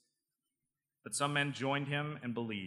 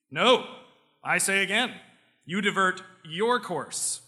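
higher, louder, slow refusal; stubborn refusal; 7 semitones higher, 7 dB louder, slower than usual. Acted. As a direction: refuse flatly and loudly. Canadian refusing in joke.

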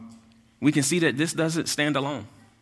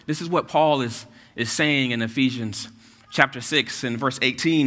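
ordinary talk, nothing marked

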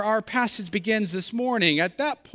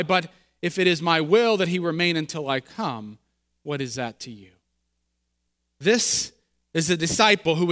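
measured, low scripture reading; 4 semitones lower than usual. Acted. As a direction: read the Gospel to the congregation. Reads the Gospel passage aloud.

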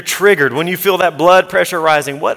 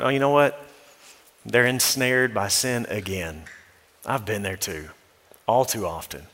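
hushed, lower, fading to almost nothing; father quietly reflecting on his child's sin, softly; soft self-talk; 5 semitones lower, 6 dB quieter than usual. Pretend. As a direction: humbly remind yourself you've been there. Imagined gentle self-talk as father.

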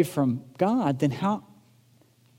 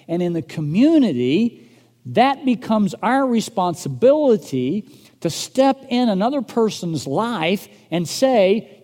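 louder, higher, driving sermon voice; preaching at full force; 6 semitones higher, 6 dB louder than usual. Acted. Pastor urging the church to step in, full-force preaching. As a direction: preach it out to the congregation, urgent.

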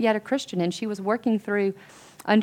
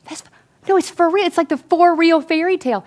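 high, loud excited insistence; acted excitement, pitched right up; retold eager assurance; 8 semitones higher, 7 dB louder than usual. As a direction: imitate friends gushing good news.

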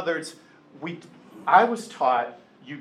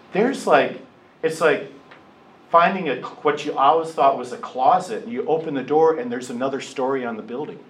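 ordinary delivery

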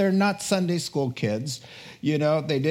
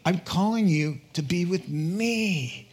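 ordinary talk

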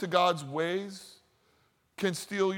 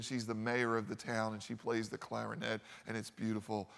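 ordinary delivery

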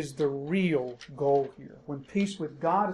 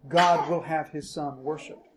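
ordinary talk

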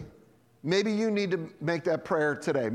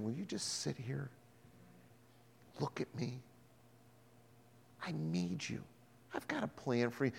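much softer, gentle invitation; hushed, tender voice of the Lord; tender plea; 3 semitones lower, 11 dB quieter than usual. Acted. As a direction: speak it softly like a loving father. Lord speaking tenderly to believer.

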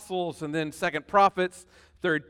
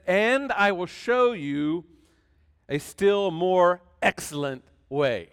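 slow scripture reading; slower than usual. Acted. Reading verse slowly.

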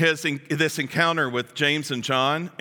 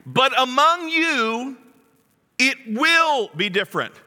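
slow, louder, leaning on will; emphatic declaration; 6 semitones higher, 4 dB louder, slower than usual. Acted. Jesus telling the twelve it will be different, emphatic. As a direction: stress will firmly. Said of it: "I love it when Jesus says".

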